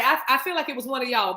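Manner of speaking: normal talking voice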